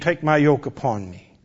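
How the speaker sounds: gentle hushed reassurance; quiet tender promise; soft divine comfort. Acted. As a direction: soothe the fearful. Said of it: "To the fatigued".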